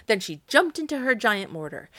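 ordinary delivery

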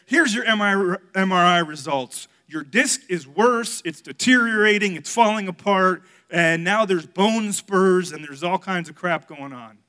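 loud, emphatic list of bad news; exasperated retelling. Doctor's MRI results retold to the church, exasperated.

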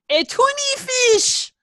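a daughter imitating her dad's punchline in an Iranian accent. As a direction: drag out tuni fish in a hammed-up Iranian accent. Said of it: "in his deep Iranian accent".

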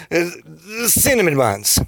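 mock-deflated groan of words; defeated. Flash finishing too early, mock defeated. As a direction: let it out as if beaten. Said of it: "that sounds kinda defeated".

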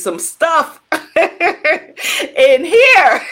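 slow quote broken up by laughter; laughing retelling. Retold or imitated. Mentor reacting to the scary book, retold through laughter. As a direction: crack up while imitating the mentor.

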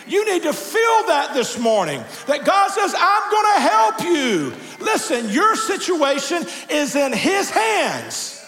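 high, shouted preaching with drawn-out key words; full-force preaching. Real, not acted. Pastor urges congregation to let go into God's hands, impassioned shouted preaching. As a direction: drive it home to the whole congregation, high and ringing.

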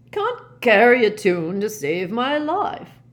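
loud, high, drawn-out; tipsy old professor. A narrator's reading. Singer apologises for his tune, loud and tipsy.

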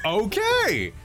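high, shouted surprise; astonished. Real, not acted. Game master reacts to player's four successes, high astonished shout. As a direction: cry it out high in real astonishment.

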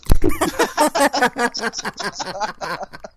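loud high burst of laughter; cracking up hard; big laugh. Real, not acted. Hearty laugh at a joke.

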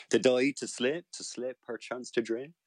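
lightly voiced Elizabethan English recital. Shown as a demonstration. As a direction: keep the Elizabethan English vowels. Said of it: "Something like this".

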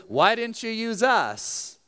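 drawn-out, high-pitched gripe; mock complaining. Ephraim comes to Gideon after battle, mock complaining. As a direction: whine it like a chronic complainer. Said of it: "They must be chronic complainers".

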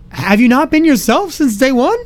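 high, slow disbelieving question; mock the claim in disbelief; slow, high sarcastic disbelief; incredulous. Incredulous inner reply to Sydney.

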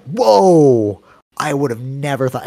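high, loud drawn-out whoa, then easing; mock amazement. Imitating jujitsu partners hearing he is thirty-five, amazed. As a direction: imitate astonishment, starting big and high. Retelling people amazed at his age.

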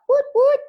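high, loud party-whistle woot woot; woot woot sound. Retold or imitated. Imitating the card's cheer sound, high and loud. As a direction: mimic a celebratory hoot, way up high. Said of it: "you know, that sort of sound".

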